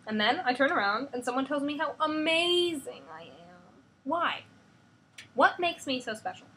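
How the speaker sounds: put-on high, loud, upset girl; angry teen. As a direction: shout it back at her father. Hope demands answers, shouting.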